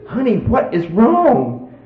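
high, drawn-out pleading question; slow, high-pitched husband's question; imitated puzzled question. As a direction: stretch it out with put-on concern. Husband questioning his hysterical wife.

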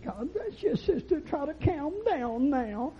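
high, slow, syrupy comforting voice; gentle mock-pious preacher; mock soothing preacher. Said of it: "she didn't need no preacher saying".

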